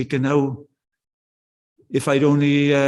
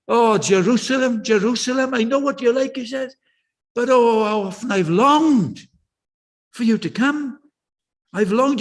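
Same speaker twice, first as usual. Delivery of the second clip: ache through every word of longing; passionate Jesus; 4 semitones higher than usual. Retold or imitated. Jesus grieving over Jerusalem, pleading with passion. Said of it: "you can feel the passion then".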